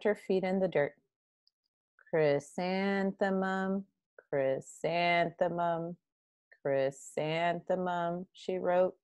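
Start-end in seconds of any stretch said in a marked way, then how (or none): 2.1-8.3 slow, softer, dejected; glum. Acted. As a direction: drag the name out sadly. Gloomy girl writing her name.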